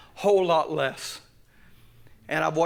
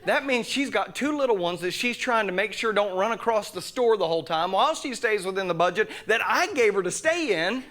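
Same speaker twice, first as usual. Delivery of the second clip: usual speaking voice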